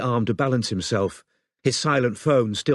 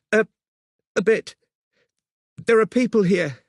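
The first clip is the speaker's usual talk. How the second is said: shaken woman; 11 semitones higher, 3 dB louder than usual. Acted. Robin hurt, shaken.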